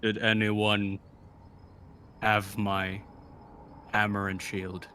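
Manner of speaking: slow drawl with long gaps; slow-motion voice. Acted. Asking the party for hammer and shield, in slow motion. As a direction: drag it out in slow-mo, word group by word group. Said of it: "shouldn't your voice be like, with slow-mo".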